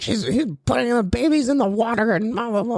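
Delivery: high, mock whining tirade; complaining old lady. Old lady complaining about the teacher, high mock nagging. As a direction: caricature a tattling complainer trailing into babble. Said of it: "pointing at me and talking".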